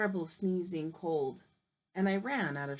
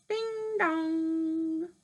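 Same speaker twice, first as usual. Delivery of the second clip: doorbell sound; 6 semitones higher than usual. Acted. Wolf rings the doorbell.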